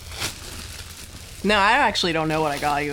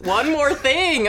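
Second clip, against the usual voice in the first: Steve Jobs reveal; 7 semitones higher, slower than usual. Retold or imitated. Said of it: "Okay, Steve Jobs".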